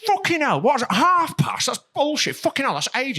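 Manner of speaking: high-pitched imitation of a friend's fury; mock furious. Retelling a friend raging at the delivery time, mock furious. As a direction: act out his outraged rant, pitch jumping up. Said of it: "he starts getting furious".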